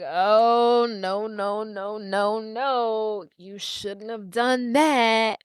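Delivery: long, drawn-out no's; slow, foreboding reproach; ominous dismay. Retold or imitated. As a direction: stretch each word in slow warning. Gort voiced warning of a mistake.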